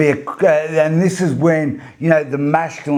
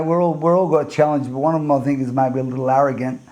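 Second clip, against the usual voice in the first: ordinary talk, nothing marked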